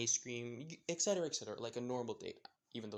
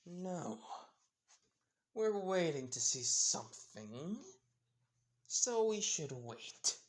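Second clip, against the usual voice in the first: menacing calm; 5 semitones higher, slower than usual. Acted. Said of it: "menacing aura around her".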